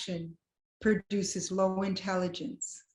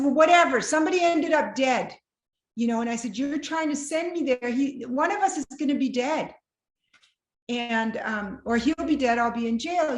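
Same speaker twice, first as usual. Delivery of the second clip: exasperated; 5 semitones higher, 6 dB louder than usual. Retold or imitated. Exasperated joking to pastor.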